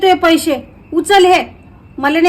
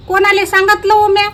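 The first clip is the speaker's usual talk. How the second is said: angry shout; 6 semitones higher than usual. Acted. Accused friend denying gossip to her friend, angry high shout. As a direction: fling the question back in outrage.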